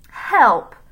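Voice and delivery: loud, high mocking cry; sneering. A narrator's reading. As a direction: read it out with scornful force. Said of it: "he sneered".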